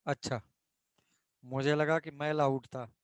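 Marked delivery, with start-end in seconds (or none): none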